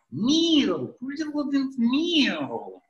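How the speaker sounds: put-on slow, high, drawn-out Russian accent. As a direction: stretch it out in a heavy Russian accent. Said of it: "speaking with a, a real accent".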